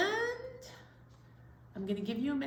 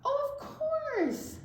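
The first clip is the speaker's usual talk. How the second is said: mock realization; 10 semitones higher, much slower than usual. Real, not acted. Teacher playing along.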